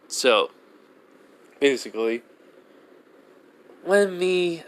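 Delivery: ordinary talk, nothing marked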